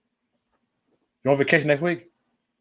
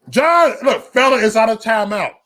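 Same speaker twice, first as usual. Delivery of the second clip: loud, high, laughing call-out; laughing yell at a viewer; laughing shout; 7 semitones higher, 6 dB louder than usual. Real, not acted. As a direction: shout it through a laugh at the chat. Laughing shout at chat member.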